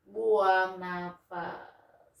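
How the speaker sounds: very slow, long exhale on the words; drawn-out exhale cue. Shown as a demonstration. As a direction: stretch it like a slow breath out.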